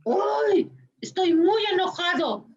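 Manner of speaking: put-on a small child shouting his anger; angry child. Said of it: "y que dice".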